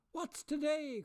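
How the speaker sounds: high, loud call. A narrator's reading. Scrooge calling down to a boy, loud call. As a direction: call down from the window. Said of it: "cried Scrooge".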